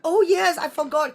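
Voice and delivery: bright high burst of remembering; sudden recall. Real, not acted. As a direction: react as if it just came back to you. Suddenly remembers a forgotten question.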